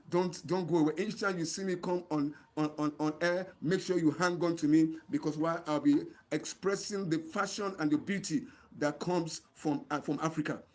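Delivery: usual speaking voice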